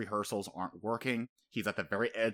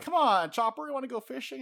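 high, put-on coaxing voice; mock nonchalant invite, voice lifted high; rehearsed casual lines; 13 semitones higher, 4 dB louder than usual. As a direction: imitate a forced, casual invitation, pitched up. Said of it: "he's doing his lines".

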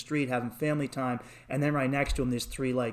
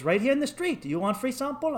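high-voiced dealer's offer; coaxing street dealer; 9 semitones higher than usual. Dealer coaxes a tourist into the street, high salesman voice. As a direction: imitate a Colombian dealer luring a tourist.

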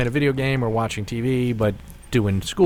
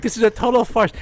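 a ridiculous fake Swedish accent, pitched way up; bad Swedish accent; 11 semitones higher, faster than usual. A fake Swede protesting the award loss in a bad Swedish accent. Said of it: "he's like".

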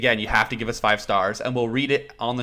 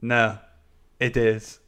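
ordinary talk, nothing marked

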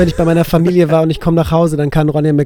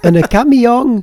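drawn-out, high East Belgian dialect; 7 semitones higher, much slower than usual. Pretend. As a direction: stretch it slowly in mock East Belgian. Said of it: "im ostbelgischen Dialekt sprechen". (ostbelgischer Dialekt, hoch und langgezogen)

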